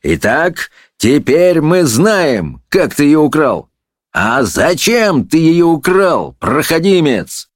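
high, slow, weighty interrogation; indignant courtroom questioning; irate judge, accusing. A narrator's reading. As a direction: grill the culprit, stretching key words.

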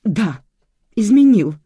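high, loud wail; anguished confession breaking out; bursting into tears. A narrator's reading. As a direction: cry out the painful admission. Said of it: "расплакалась женщина".

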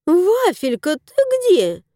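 woman searching calls her cat; calling the cat. A narrator's reading. Женщина зовёт кота, высоко и с подъёмом.

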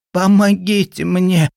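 dying man wheezing for help; wheezing plea. A narrator's reading. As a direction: rasp it out, barely breathing. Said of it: "просипел Говоров".

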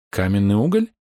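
mild surprise, rising sharply at the end; surprised question. A narrator's reading. Храбр examines a broken piece, mildly surprised. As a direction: ask it with a touch of amazement. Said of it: "удивлённо спросил".

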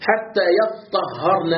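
"حتى يطهرن" slowly, with the doubled ط drawn out; second reading shown. Shown as a demonstration. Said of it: "على القراءة الثانية". ("حتى يطهرن" ببطء مع تشديد الطاء)

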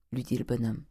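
usual speaking voice

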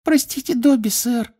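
very high guilty squeak; squeaky guilty elf. A narrator's reading. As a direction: squeak out an apology like a tiny elf. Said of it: "виновато пискнул эльф".

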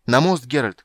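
shouted command. A narrator's reading. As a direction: shout an order over the chaos. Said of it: "крикнула чародейка".

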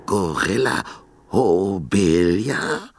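slow, stammering fearful question; terrified stammer. A narrator's reading. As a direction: stutter the name with chattering teeth. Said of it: "stotterte er zwischen klappernden Zähnen".

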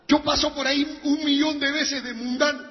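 high, loud mounting complaint; aggrieved protest. Acted.

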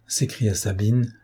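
ordinary delivery, nothing marked

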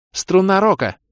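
very high, loud spell cry; battle call. A narrator's reading. Кэлен backs up the hero, shouting a spell.

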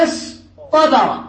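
اصطبر slow, split before the heavy ṭ; emphatic ṭāʾ. Shown as a demonstration. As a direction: say اصطبر in two parts with emphatic ط. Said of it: "أبدلوا بدل التاء". (نطق اصطبر بطاء مفخّمة ببطء)

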